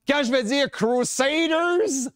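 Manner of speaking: Crusaders said slowly, the English word effortful in a French mouth; slow down on Crusaders as if it twists the tongue; struggling pronunciation. Shown as a demonstration. Francophone speaker showing how Crusaders trips him, slow. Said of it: "ça tourne dans ma bouche".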